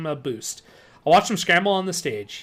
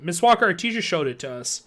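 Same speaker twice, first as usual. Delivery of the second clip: plain reading voice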